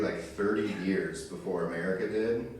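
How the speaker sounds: ordinary talk, nothing marked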